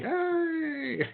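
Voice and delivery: very high gleeful yay; excited squeal. Real, not acted. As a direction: squeal with delight. Celebrating a yes on call.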